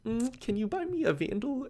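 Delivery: imitating a girl sweetly asking for a free gun. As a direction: coo it like a girl asking a favour. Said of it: "the girl would be like".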